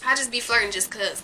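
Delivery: ordinary delivery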